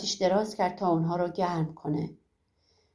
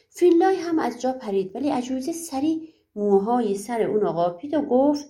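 usual speaking voice

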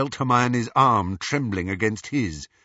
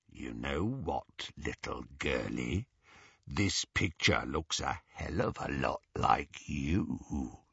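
Greyback; 8 semitones lower, 7 dB quieter than usual. A narrator's reading. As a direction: taunt the captive in a hushed, deep villain voice. Greyback menaces his captive.

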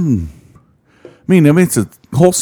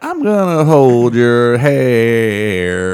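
very slow; 2 semitones higher, much slower than usual. Pretend. Mock gallant offer, drawn out.

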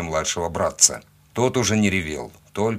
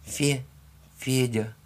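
put-on higher, small halting child voice; squeezed out; 5 semitones higher, 4 dB quieter, slower than usual. A narrator's reading. Small frightened boy giving his name to his rescuer, forced out. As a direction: stammer the name out with effort. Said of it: "выдавил он".

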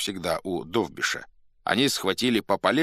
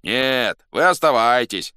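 loud, higher call; shout from the crowd; 4 semitones higher, 7 dB louder than usual. A narrator's reading. Crowd tells the officers to stay, shouting. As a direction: call it out to them. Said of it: "закричали из толпы".

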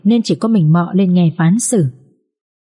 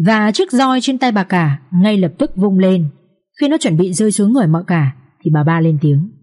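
ordinary delivery

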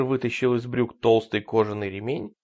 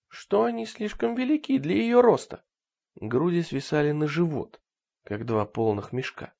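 ordinary delivery

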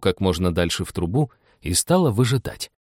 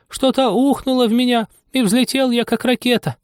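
tiny squeaky lizard voice; squeaky little voice; 14 semitones higher than usual. Acted. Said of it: "слабый скрипучий голосок".

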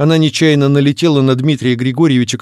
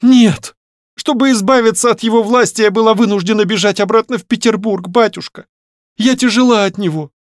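high-pitched, urgent confession, voiced rather than whispered; distraught daughter; 10 semitones higher, 3 dB louder than usual. A narrator's reading. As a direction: pour it out to her father in one breath. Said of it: "тихо прошептала на одном дыхании". (взволнованно, высоким девичьим голосом)

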